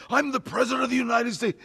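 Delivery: high, loud, quick outburst; imitated yell. Retelling Sheen's big scene, imitated yell. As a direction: yell it like the convincing actor. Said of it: "he jumps up and yells".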